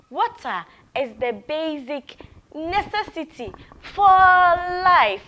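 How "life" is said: "life" punched high and loud; stress on life. Shown as a demonstration. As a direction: hit "life" hard for the class to copy. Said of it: "do the actions after me".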